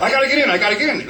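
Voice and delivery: high, fast, frantic begging; mock panic. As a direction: rush it like you're being chased. Friend chased by dog.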